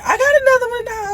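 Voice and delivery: high, breaking voice close to tears; real grief spilling into the words; crying. Real, not acted. Grieving her dead dog.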